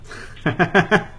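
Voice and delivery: high, rising chuckle; brief real laugh climbing in pitch. Real, not acted. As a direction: laugh it off lightly. Laughs off comment about low energy.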